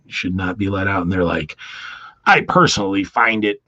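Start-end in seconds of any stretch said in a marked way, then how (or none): 2.2-3.6 1 pissed official. Acted. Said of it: "he's really pissed".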